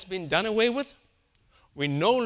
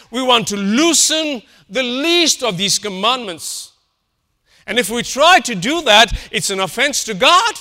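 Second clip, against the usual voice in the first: preaching at full force; 4 semitones higher, 6 dB louder than usual. Acted. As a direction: thunder it from the pulpit, building.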